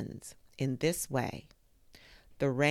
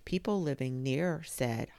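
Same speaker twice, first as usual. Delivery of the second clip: plain reading voice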